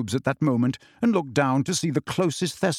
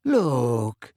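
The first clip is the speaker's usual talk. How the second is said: long, dreamy drawn-out word; airy, unhurried character voice; smiling. A narrator's reading. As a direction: draw it out serenely through a smile.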